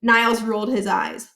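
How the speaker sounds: usual speaking voice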